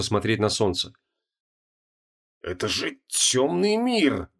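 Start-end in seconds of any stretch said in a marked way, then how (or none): 2.4-4.3 slow, strained wheeze; wheezing. Acted. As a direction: force it out on a thin wheezing breath. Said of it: "просипел Креол".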